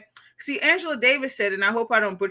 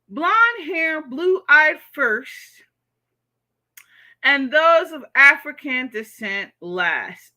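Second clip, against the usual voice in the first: slow, deliberate, louder reading; slow emphatic reread; 4 semitones higher, 5 dB louder, much slower than usual. Real, not acted. Rereading Clouds' comment to viewers, slow and pointed. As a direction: let each word land.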